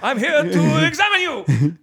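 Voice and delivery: high, slow shouted doctor voice; belligerent drunk. Acted. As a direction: bellow it out like a crazed doctor. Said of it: "a voice talking over a P A system".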